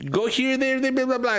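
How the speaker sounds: high-pitched mock broken English directions. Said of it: "But it's broken English".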